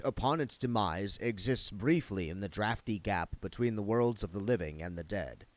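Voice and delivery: ordinary delivery, nothing marked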